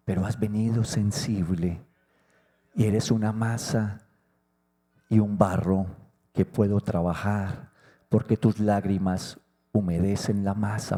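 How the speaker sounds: low, soft voice of God; God speaking tenderly. Acted. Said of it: "Y entonces Dios lo percibe y dice". (Dios hablando con ternura, grave y suave)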